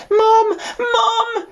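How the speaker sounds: very high, loud crying call for mum; terrified shout. Acted. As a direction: wake screaming for his mother. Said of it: "shouted Babe in terror".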